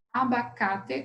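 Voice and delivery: "Abacate" ending in a clear te, not chi; careful syllables. Shown as a demonstration. Teacher preparing to count, "Abacate" carefully sounded out. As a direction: enunciate every syllable of "Abacate" with full final e. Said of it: "Quantas vezes você abre a boca?".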